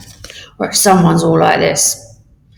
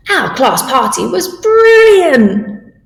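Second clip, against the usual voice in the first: higher, slow and delighted; excited; 8 semitones higher, 4 dB louder, much slower than usual. Shown as a demonstration. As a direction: gush about the best party ever. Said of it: "say it with feeling".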